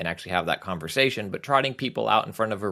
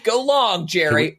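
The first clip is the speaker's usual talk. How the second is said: mock cheer; 8 semitones higher, 5 dB louder, slower than usual. Mock cheering at Jerry Rice.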